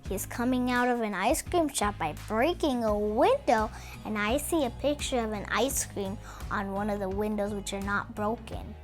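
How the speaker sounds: ordinary delivery, nothing marked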